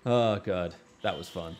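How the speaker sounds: normal talking voice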